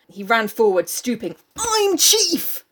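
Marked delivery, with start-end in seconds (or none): 1.6-2.6 high, crowing cry; acted proclamation. Jack declaring himself chief to Ralph, triumphant cry. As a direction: cry it out like a boy claiming power. Acting out Jack seizing power.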